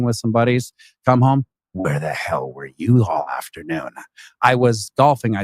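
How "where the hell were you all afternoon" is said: low, hushed, ominous trailer voice; movie trailer voice. Retold or imitated. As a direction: growl it like a dark movie trailer. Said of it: "that's my movie trailer voice".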